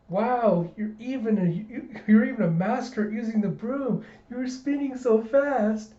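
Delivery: put-on high, gushing little-girl voice. Anya fawning over Desmond, high and gushing. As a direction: gush like an awed kid.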